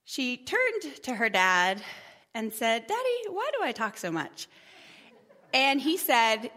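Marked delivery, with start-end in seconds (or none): 2.9-4.5 high-pitched daughter voice; high, plaintive little question to Daddy; Leah. Acted. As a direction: imitate a daughter asking her dad, pitched way up. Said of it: "my daughter Leah".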